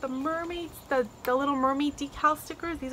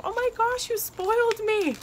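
real delight; 10 semitones higher than usual. Real, not acted. Thanking a friend for the gifts, delighted. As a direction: squeal it, stretching the last words.

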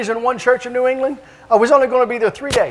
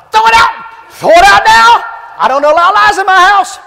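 high, loud furious shout; 12 semitones higher, 10 dB louder than usual. Retold or imitated. Preacher acting Wigglesworth shouting at young Lester, loud fury. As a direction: bellow the order. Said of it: "he shouted".

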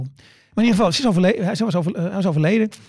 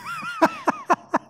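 high burst of laughter; laughing; 4 dB quieter than usual. Real, not acted. Comedian laughs at the audience's condolences, high burst. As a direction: crack up at the memory.

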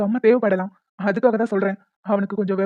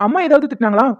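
put-on higher-pitched, doting baby-talk lilt; coaxing a child; 3 semitones higher than usual. Father comforts his crying little daughter, coaxing and doting. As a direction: coax the little one out of tears.

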